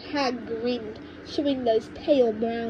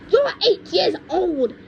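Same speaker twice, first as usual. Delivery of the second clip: high and loud, snorting it out; 7 semitones higher, 7 dB louder than usual. A narrator's reading. Grandma scoffing at George's age, loud and snorting. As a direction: snort with scorn at the boy. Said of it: "she snorted".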